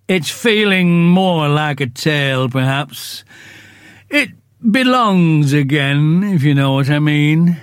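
slow, mournful donkey voice complaining; gloomy Eeyore. Acted. Eeyore grumbles to the others about his cold tail, slow and mournful. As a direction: drag each word out like a sad old donkey.

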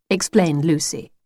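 usual speaking voice

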